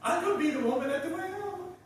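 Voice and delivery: imitating a sweet little girl in a church drama; playing the cute girl volunteer. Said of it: "it had a sweet little voice".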